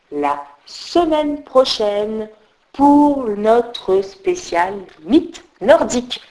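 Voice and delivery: very slow, spelled-out announcement; host announcing next week's special, very slowly; slow repeat. Shown as a demonstration. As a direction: draw out every word so nobody forgets. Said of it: "Je le répète encore".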